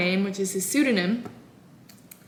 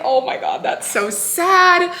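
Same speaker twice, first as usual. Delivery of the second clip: louder, higher, drawn-out mock sympathy; mock pity; 7 semitones higher, 6 dB louder, slower than usual. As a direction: ham up the pity, stretching "so sad". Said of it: "And I'm just like".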